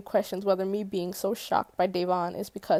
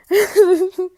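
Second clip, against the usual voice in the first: loud, high burst of laughter; laughing at own slip; 10 semitones higher, 12 dB louder than usual. Real, not acted. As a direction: burst out laughing at your mistake. Laughs at own name mistake.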